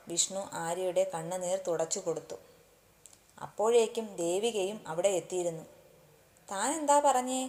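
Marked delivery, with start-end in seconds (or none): 6.5-7.4 higher-pitched, pointed challenge; accusing question. A narrator's reading. Friend confronts him over the crying girl, accusing. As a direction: demand an answer, voice lifted.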